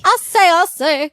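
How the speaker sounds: high, loud, slow cartoon rooster drawl; Foghorn Leghorn. Podcaster echoes her cohost with a high, loud Foghorn Leghorn voice. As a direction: crow it like a blustery cartoon rooster. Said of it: "it's foghorn leghorn".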